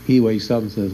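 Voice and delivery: ordinary delivery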